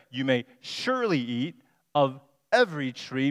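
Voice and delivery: "surely" and "every" leaned on; stress on surely and every. Shown as a demonstration. Preacher quoting God's fuller command, stressing surely and every. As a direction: mark "surely" and "every" clearly. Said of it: "She leaves out the words, "surely" and "every".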